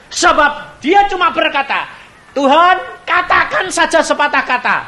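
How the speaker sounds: high, loud sermon delivery; preaching. Real, not acted. Preacher exhorting the congregation to faith, high and loud. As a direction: preach it with full force.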